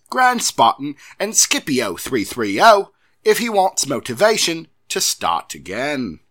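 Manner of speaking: loud, hammed-up English accent; Michael Caine. Host reading listener's email to co-host, exaggerated English accent. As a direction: read on in broad, lively stagey English. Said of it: "I appreciated the Michael Caine".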